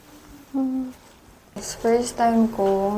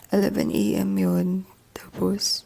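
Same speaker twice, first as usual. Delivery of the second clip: whispering; 3 semitones lower than usual. Real, not acted. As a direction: whisper so no one wakes. Early morning hotel room whisper.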